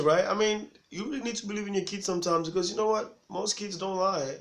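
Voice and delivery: ordinary talk, nothing marked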